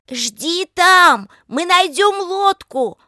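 high, loud drawn-out call; shouting. A narrator's reading. Lika promising Pavel a boat, high loud shout. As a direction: shout reassurance over the distance. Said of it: "прокричала Лика".

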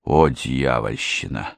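low, slow muttered oath; spat-out curse. A narrator's reading. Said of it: "сплюнул Уайт". (низко и медленно, с досадой)

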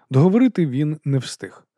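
ordinary delivery, nothing marked

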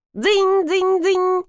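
high, ringing "dzyn" repeated on one note; imitated timer ringing. A narrator's reading. Timer goes off in the corridor, high imitated ringing. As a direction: ring out like a timer bell. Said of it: "раздалось из коридора".

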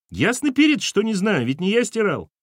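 high, loud cheeky retort; cheeky boy. A narrator's reading. Son retorting to mother, high and loud. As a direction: sass your mother back.

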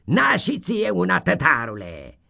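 lightly voiced schoolmaster; mild teacher voice; tossing a treat. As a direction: hand it over grandly. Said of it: "n-a zis domnul Vucea".

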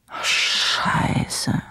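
hushed, startled whisper; muttered in alarm; whispered curse. Acted. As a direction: curse under the breath. Writer catches himself.

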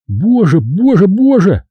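rising terrified cry of боже; panicked cry. A narrator's reading. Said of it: "закричал он".

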